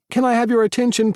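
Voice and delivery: high, loud showman announcing to the cafe; loud announcer voice. Acted. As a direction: call the room to order, big and bright.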